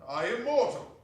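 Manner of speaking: loud, high protest; remonstrating. Acted. Scrooge protests to the spirit, loud remonstration. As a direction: object in alarm. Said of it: "Scrooge remonstrated".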